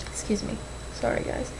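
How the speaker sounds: apologising to viewers while crying; tearful apology. Real, not acted. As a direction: whisper through real tears. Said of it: "I'm tearing away".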